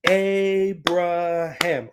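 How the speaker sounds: Abraham split syllable by syllable, very slow; broken down slowly. Shown as a demonstration. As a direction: sound out Abraham for kids. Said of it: "You do".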